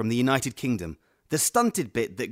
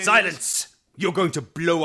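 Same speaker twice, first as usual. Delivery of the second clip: sharp villain shout, louder and higher; shouted command; 5 semitones higher, 4 dB louder than usual. Acted. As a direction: bark it like an evil overlord. Villain yells at cowering henchman.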